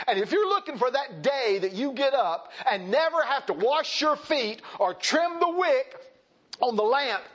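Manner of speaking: forceful pulpit build; emphatic preaching. Real, not acted. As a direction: drive each phrase at the congregation. Preaching at raised pitch.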